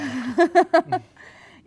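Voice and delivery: short loud laugh; burst of laughter. Real, not acted. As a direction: laugh out at the joke. Laughs at "c'est ma femme" joke.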